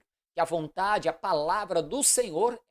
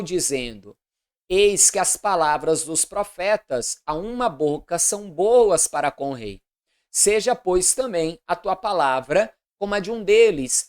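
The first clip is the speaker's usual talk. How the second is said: loud, declamatory Bible reading; formal proclamation of an Old Testament narrative; solemn scripture reading; 7 dB louder than usual. A narrator's reading. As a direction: read scripture aloud with measured, deliberate phrasing.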